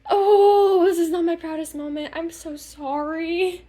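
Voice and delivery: loud drawn-out "oh", then mock-tearful apology; retold bawling. Quitter bawling as father waits outside, imitated crying. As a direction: imitate yourself wailing an apology. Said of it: "bawling tears".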